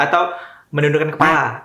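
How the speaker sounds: ordinary talk, nothing marked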